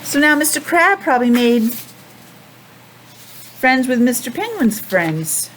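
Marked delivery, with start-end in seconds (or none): none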